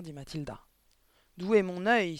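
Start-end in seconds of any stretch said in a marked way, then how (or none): 1.4-2.2 louder, scoffing outburst; scornful exclamation. Acted. Headmistress dismissing the father's talent, scoffing exclamation. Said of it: "s'exclama mademoiselle Le Gourdin".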